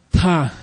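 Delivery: 'ta' with a big puff of breath; breathy aspirated tone. Shown as a demonstration. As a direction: sigh out 'ta' like you're tired. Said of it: "like you're tired".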